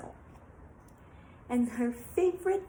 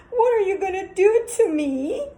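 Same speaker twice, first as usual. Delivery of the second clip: high loud frightened question; scared girl; 8 semitones higher, 10 dB louder than usual. Acted. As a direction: ask in terror. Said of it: "Rapunzel was always scared".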